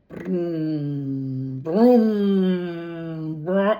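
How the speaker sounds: long, low hummed brum; imitated engine revving. Reader supplies the car sound effect, drawn-out engine imitation. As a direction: hum a racing car revving up. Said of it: "a revving of a racing car engine".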